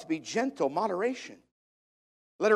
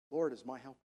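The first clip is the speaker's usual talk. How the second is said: soft, low, private recitation; hushed promise; 6 semitones lower, 10 dB quieter, faster than usual. Pretend. Someone alone as the walls move in, hushed. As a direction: say it quietly as if all alone.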